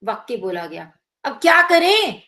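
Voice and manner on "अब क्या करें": louder and higher, stretching the words out; drawn out, different feeling. Shown as a demonstration. Teacher demonstrating the same sentence in a drawn-out exasperated tone. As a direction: drag it out with exasperation. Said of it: "जैसे हम खींच कर कुछ अलग भाव में".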